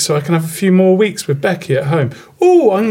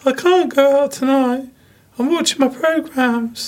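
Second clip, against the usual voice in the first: put-on higher-pitched girly voice; man doing a woman's voice; 8 semitones higher than usual. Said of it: "Higher pitched".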